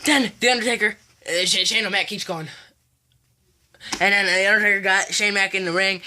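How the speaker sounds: ordinary talk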